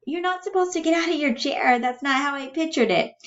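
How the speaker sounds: high-pitched protest; upset Bella. Acted. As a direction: object in a high character voice. Reader voices Bella protesting.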